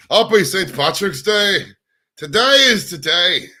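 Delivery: loud, high, drawn-out mock Irish accent. Shown as a demonstration. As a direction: belt out a hammed-up Irish brogue. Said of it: "In my best Irish accent".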